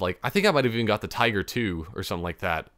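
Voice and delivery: ordinary talk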